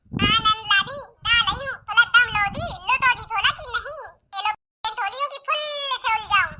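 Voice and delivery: talkative lisping daughter-in-law. Acted. Daughter-in-law asking impatient guests to wait, lisping. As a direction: lisp it, turning r into l. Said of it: "तोतली भाभी की जय हो".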